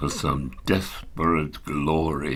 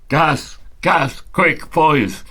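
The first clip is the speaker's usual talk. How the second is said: sudden high, loud cry of warning; shouted alarm; 10 semitones higher, 5 dB louder than usual. Acted. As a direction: yell it out to the men in panic. Soldier shouting gas warning.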